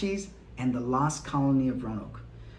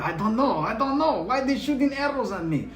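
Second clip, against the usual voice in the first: high, hammed-up French accent, much higher; bad French accent; 9 semitones higher, 3 dB louder than usual. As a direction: play a clueless Frenchman in a deliberately awful French accent. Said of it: "the worst French accent I've ever done".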